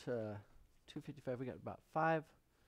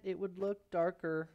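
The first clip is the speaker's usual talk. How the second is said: ordinary talk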